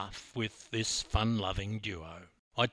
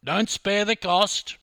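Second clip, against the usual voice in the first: loud, high, grand; shout; 8 semitones higher, 8 dB louder than usual. Retold or imitated. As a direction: boom it out generously. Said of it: "I shouted".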